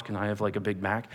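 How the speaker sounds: small, low, timid order; insecure. Acted. Lone college guy ordering at McDonald's, insecure. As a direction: order softly, unsure of yourself. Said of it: "he's, like, all insecure walking into McDonald's".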